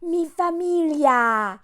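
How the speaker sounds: slow, exaggerated fake Spanish accent; mocking her fake Spanish heritage in a put-on Spanish accent.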